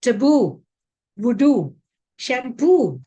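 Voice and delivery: Taboo, voodoo, shampoo, each punched loud on the final OO; stress on last syllable. Shown as a demonstration. Teacher drilling double O words, stress on the last syllable. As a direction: land hard on the last syllable of each word. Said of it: "that is how we'll have to practice".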